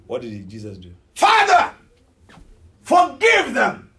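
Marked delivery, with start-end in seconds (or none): 1.1-3.9 high, slow shout to the Father; anguished cry. Acted. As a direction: cry out in pain, drawing out the plea. Jesus crying out while nailed.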